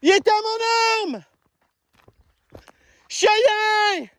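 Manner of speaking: high, drawn-out call across the woods; long high hail to a lost dog. Real, not acted. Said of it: "ça fait une heure que je crie".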